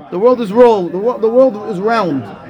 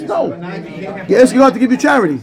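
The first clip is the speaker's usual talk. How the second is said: usual speaking voice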